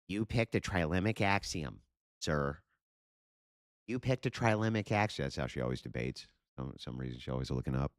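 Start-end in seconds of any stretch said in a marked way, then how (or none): 0.1-5.3 higher sneering parody, stressing picked; lightly mocking smug debater voice. Streamer parroting the debater, higher and sneering.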